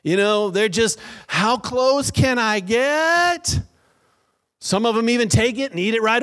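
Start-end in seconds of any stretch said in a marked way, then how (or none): 1.2-3.6 high, slow, stretched-out imitation of a kid; tempted little kid. Kid testing the candy rule, drawn-out high mock voice. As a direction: draw it out, inching toward forbidden candy.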